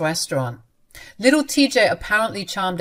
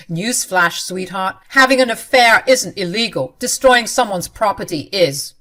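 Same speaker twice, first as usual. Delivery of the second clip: angry retort; 2 semitones higher, 8 dB louder than usual. Acted. Husband snaps back at wife.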